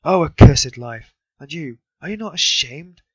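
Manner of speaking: loud, high, slow curse; lament, then turn on him; wife curses her life and shames the stranger; bitter outcry. A narrator's reading. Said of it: "She pounced all at once".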